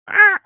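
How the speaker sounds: sudden high yelp of surprise; a sharp startled cry. Real, not acted. Streamer yelps at a chat bot, startled.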